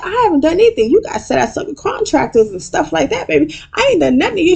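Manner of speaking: very high whiny mock Quad; Quad impression. Quad denying everything to Toya, in a very high mock voice. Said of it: "And Quad's like".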